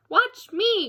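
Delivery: put-on high, loud child call. Little bear calls grandpa to watch, loud and high. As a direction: shout it up to grandpa. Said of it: "called Little Bear".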